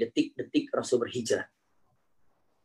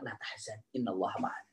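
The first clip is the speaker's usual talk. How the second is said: hushed, low comfort; almost breathe the words; soft consolation; 6 semitones lower, 12 dB quieter than usual. Acted. The Prophet quietly comforting Abu Bakar.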